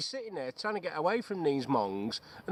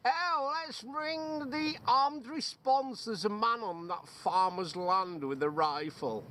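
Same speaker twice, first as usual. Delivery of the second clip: high, slow mock Karen whine; mock Karen voice phoning the armed police; 6 semitones higher, slower than usual. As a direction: take off a fussy busybody calling the police. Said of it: "Karen and Carl".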